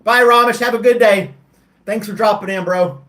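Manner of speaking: loud, high friendly goodbye; calling out. Real, not acted. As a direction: call it out brightly. Calls goodbye to chat viewer.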